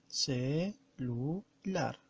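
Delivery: "celular" split slowly as ce, lu, lar; syllable by syllable. Shown as a demonstration. Teacher counting the syllables of celular, syllable by syllable. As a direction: draw out each syllable of "celular" with pauses between. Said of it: "Vamos a pronunciar".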